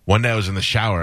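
ordinary talk

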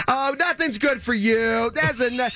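high, mock whiny complaining voice, rising to a shout; imitating the president's high exasperated rant; mocking shrill board president. [name] mimics the board president snapping at him, high and whiny.

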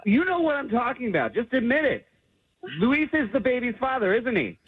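heated accusation, pitch jumping high; real accusing. Real, not acted. Fiance confronting new mother over baby's father, accusing. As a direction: confront her, voice pitched right up.

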